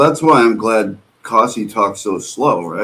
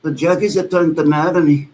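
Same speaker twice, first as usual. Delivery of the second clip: high-pitched imitation of a Scottish accent; high Scottish-accent mimicry, repeating the opening words; Nicola's accent; 6 semitones higher, faster than usual. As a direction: put on a rough, butchered Scottish accent. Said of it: "with her accent, I have no idea".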